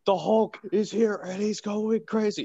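high-pitched, urgent mock panic; alarmed Pepper. Pepper calls good old Tony, mock alarm.

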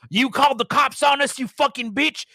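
loud, high enraged yelling; angry stalker shouting at the woman in her car; furious shouting. A narrator's reading. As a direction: shout abuse through the window. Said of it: "started shouting at me through the window".

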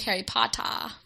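British "Potter" ending in an open "ah"; British accent. Shown as a demonstration. Co-host correcting the impression, in a British accent. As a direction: demonstrate a British accent, dropping the final R. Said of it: "Gotta remember that British accent".

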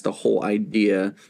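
ordinary talk

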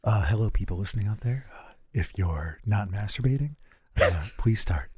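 low, soft, creepy; creepy ASMR voice. Pretend. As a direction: lean into the creep, quietly. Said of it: "just to be super creepy about it".

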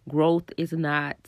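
normal talking voice

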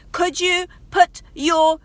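exasperated mum raising her voice at a dawdling son. Retold or imitated. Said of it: "it went to this".

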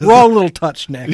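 dramatic. Acted. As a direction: proclaim it like a madman. Mock dramatic loud line.

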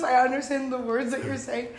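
soft, lightly mimicking take; mimicking imitation. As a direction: parrot the line softly back. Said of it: "The way he goes".

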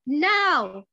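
loud, sudden cry; screamed signal. A narrator's reading. Flo signals Lucy to jump out, screamed. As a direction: yell the signal to attack. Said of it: "Flo screamed".

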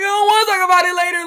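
high, loud comic wail; mock whining. Child dreading a later talk with mother, mock whining.

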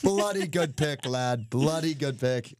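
high, slow mock British drawl; hammed-up British lad, slipping toward Australian; bad British accent. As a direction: put on a drawn-out fake British accent. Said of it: "That was kind of Australian too".

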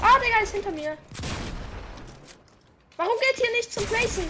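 high, loud frantic yelling; panicked shout. Real, not acted. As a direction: yell in panic. Enemy behind him.

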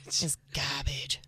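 slow, hushed whisper on GARBAGE; whispered punchline. Acted. Comic repeating the verdict on the date, whispered. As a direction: whisper it, drawn out and low.

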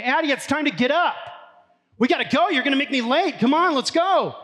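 high, loud call up the stairs. Retold or imitated. Dad calling his daughter from the top of the stairs, high loud yell. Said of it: "Usually I will stand at the top of the stairs and I'll say".

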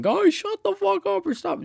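high-pitched, slow imitated yelling; mock yell. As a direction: mimic her yelling at the guys in a high voice. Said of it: "she was, like, yelling".

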